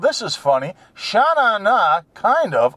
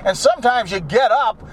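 normal talking voice

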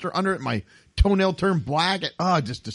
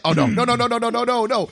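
rising high frantic pleading; panicked no no no; 9 semitones higher than usual. Retold or imitated. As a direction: plead higher and higher as it grips. Retelling foot cramp starting.

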